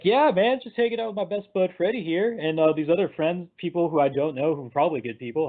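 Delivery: high, showy best-buddy voice; Shazam hamming it up. Retold or imitated. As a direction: start big and high, then ease down. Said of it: "just totally hamming it up".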